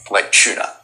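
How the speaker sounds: "tuna" said as chuna, Aussie style; "tuna" with a ch sound, chuna; chuna pronunciation. Shown as a demonstration. Australian guest spelling out how he says tuna, as chuna. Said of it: "it's like C H U N A".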